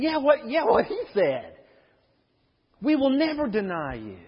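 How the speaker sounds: high, quick "me too" agreement; eager disciples chiming in. Acted. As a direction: pile on eagerly after Peter. Disciples echo Peter eagerly.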